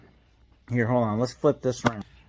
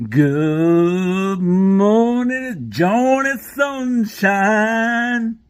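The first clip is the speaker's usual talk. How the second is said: high, slow, drawn-out mock morning greeting; John Phillips Plumbing impression; 7 semitones higher, 9 dB louder, much slower than usual. Plumber vlogger parody greeting his viewers, high and drawn out. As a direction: stretch the greeting out like a cheery YouTube plumber. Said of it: "I tried to give you a little, uh, John Phillips Plumbing".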